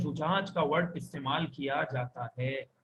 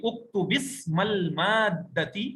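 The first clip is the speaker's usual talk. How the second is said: slow model reading; much slower than usual. Shown as a demonstration. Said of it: "दोबारा पढ़िए".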